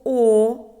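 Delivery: "о" held long and loud; "о" drawn out on a level note; isolated vowel. Shown as a demonstration. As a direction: sound out a sustained, clear "о" for pupils. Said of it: "заховався звук".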